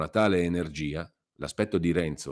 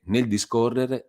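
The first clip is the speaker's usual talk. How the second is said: ordinary delivery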